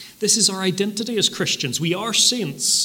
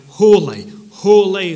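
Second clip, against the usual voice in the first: loud ringing cry of Holy; seraphim crying out; 4 semitones higher, 10 dB louder, slower than usual. Retold or imitated. Preacher voicing the seraphim's cry before the Lord, loud proclaiming. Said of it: "They cried".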